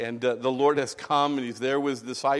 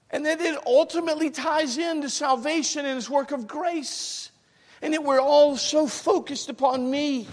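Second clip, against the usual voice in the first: high, loud pulpit fervor; impassioned sermon delivery; fervent preaching; 14 semitones higher, 5 dB louder than usual. Acted. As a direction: preach it with rising urgency. Preaching at full force.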